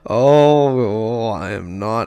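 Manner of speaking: loud wailed start, then slow grumbling; drawn-out unhappy moan; tearful complaint. Real, not acted. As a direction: lament it slowly, upset and worked up. Player crying over the route.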